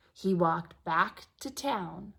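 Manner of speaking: ordinary delivery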